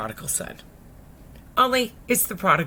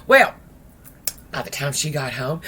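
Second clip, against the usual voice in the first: ordinary talk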